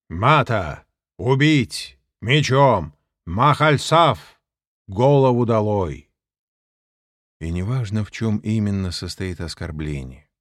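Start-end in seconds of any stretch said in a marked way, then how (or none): 0.1-6.1 booming higher, much louder announcer call; stadium announcer. Acted. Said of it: "голосом диктора на стадионе".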